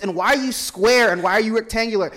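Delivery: loud, high hammed-up yelling at furniture; mock rage at table. To a friend, acting out stubbing an elbow: hammed-up mock fury. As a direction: scream at the table that hurt you.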